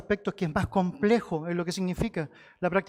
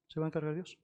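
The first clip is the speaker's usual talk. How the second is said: hushed echo; 3 semitones lower, 11 dB quieter, faster than usual. Real, not acted. Softer echo of the line.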